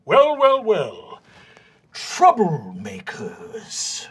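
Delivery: theatrical, drawn-out disapproval; stern vice principal. Acted. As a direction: start high, then sink slowly into the accusation. Game master voices stern vice principal.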